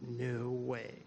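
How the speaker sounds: very soft, stunned disbelief; hushed amazement. Retold or imitated. Stress suddenly all gone, hushed amazement. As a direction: let it out quietly in awe.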